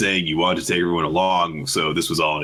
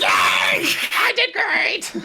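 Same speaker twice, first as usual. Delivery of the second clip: put-on much higher, breathless little-kid yay; gleeful cartoon kid squeal; squeaky kid cheer; much slower than usual. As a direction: cheer like a tiny overjoyed gremlin. [name] cheering at praise.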